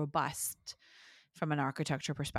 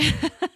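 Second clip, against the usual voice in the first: a quick, high, amused laugh; short laugh; 12 semitones higher, 8 dB louder than usual. Real, not acted. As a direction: laugh lightly at your own request. Laughs asking for a definition.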